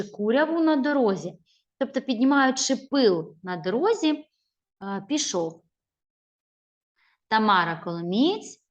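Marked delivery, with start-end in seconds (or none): none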